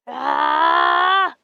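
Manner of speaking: scream. Real, not acted. Screaming at the game.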